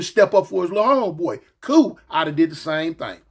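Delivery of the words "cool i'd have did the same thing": ordinary talk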